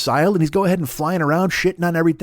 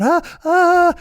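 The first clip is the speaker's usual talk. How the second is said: mock scream; 13 semitones higher, 5 dB louder than usual. People screaming at owl.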